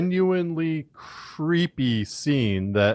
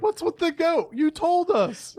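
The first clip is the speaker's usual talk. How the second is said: very high, incredulous retort; high-voiced comic protest; mock bafflement. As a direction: imitate someone baffled, pitched way up. Acting out her baffled reaction.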